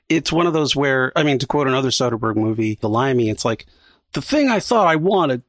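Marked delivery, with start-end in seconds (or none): 4.1-5.4 bad Cockney accent, quoting a film; lightly put-on Cockney voice. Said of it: "that's a terrible Cockney accent".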